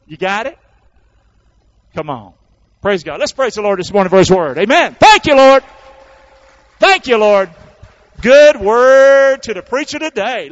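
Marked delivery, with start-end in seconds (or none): 2.8-10.5 loud, high praise shouted to the congregation; full-force preaching. Acted. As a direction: lift the room with loud thanks to the Lord.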